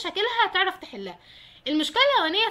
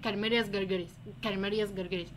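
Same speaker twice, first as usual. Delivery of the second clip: quiet repeating to oneself; 7 semitones lower, 6 dB quieter than usual. Shown as a demonstration. Student drilling a hard worm name alone, softly murmured. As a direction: repeat the worm name under your breath, lower and softer. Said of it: "انت هتقعد تكرر اسم الدوده".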